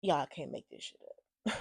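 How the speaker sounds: low, soft, deflated; subdued disbelief. Real, not acted. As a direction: mutter it in quiet disbelief. Disbelief, first take, subdued.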